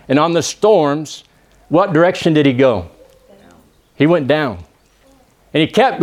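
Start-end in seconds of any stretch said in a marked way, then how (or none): none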